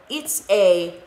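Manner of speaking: proud, rising declaration; declaring. Acted. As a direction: announce it like a little astronaut. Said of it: "declared Scipito".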